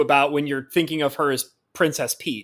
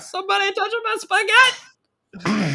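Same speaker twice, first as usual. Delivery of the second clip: Mario meme voice; 13 semitones higher than usual. Retold or imitated. Joking to a friend about a Mario restaurant, hammed-up Mario voice. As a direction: ham up the spaghetti meme like Mario. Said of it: "have Mario fully act out that meme".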